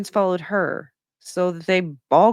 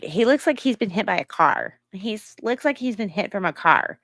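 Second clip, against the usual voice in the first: higher-pitched mimicking voice; mock the words in a raised, put-on voice; imitating someone mockingly parroting a remark; 4 semitones higher than usual. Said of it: "she says it mockingly like that".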